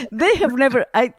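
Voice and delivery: normal talking voice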